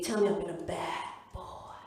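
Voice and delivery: low, slow American seducer; drawn-out mock-sexy American voice; American accent. Partner talking dirty in bed, slow American accent. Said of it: "I give him an American accent".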